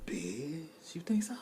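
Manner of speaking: breathy whisper to the crowd; whispered aside. Real, not acted. Whispered aside to the audience. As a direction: confide it to the audience.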